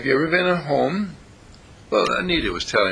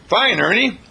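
cheerful reply; 7 semitones higher, 5 dB louder than usual. Pretend. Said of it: "And they say".

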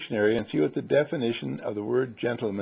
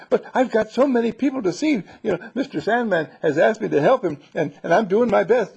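high, louder eager little helper voice; a busy little helper pleading to keep working; high, eager sprite voice; Ingeborg's voice; 8 semitones higher, 5 dB louder than usual. Acted. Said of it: "Ingeborg says".

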